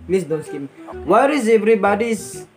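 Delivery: fake Indian accent. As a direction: lay on a put-on Indian English accent, friendly.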